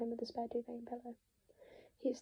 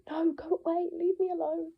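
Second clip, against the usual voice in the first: high, loud, desperate shout; frantic yell; 6 semitones higher, 7 dB louder than usual. Acted. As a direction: drive her out of the room. Brother screaming her away.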